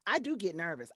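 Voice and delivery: ordinary delivery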